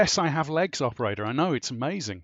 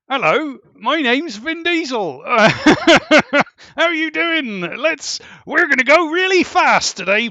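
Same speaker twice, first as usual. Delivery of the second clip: much higher and louder, squeaky chirpy tough guy; silly high voice; 9 semitones higher, 11 dB louder than usual. Pretend. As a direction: play the hard man with a ridiculous squeaky voice. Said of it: "imagine Vin Diesel, only".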